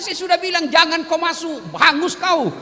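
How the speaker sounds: high, loud frantic warning; high warning shout. Retold or imitated. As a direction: warn him off at the top of your voice. Villager warning loudly, high voice.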